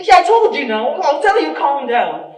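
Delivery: loud, high re-enacted telling-off; imitated yelling. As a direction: yell it as in the quarrel. Re-enacting yesterday's yelling at sister.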